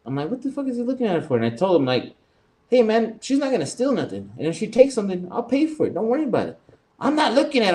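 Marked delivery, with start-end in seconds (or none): 0.3-1.3 fast, high-pitched indignant question. Retold or imitated. Customer questioning why the clerk watches the lady, indignant. 2.7-6.6 high-pitched, insistent retold protest; insistent defending. Customer telling the clerk he'll pay for her, insistent. 7.0-7.8 very high, indignant mock protest; mad clerk imitation. Store clerk denying he watched the lady, mock indignant.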